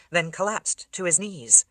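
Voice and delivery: ordinary delivery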